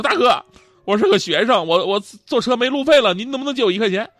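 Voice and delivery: high, loud mock plea; acted-out appeal for a small favor; pleading student. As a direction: stop a stranger and beg earnestly. Student begs stranger for money.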